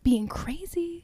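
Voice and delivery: unvoiced, conspiratorial whisper; gossipy whisper. Real, not acted. Dishing on the villain.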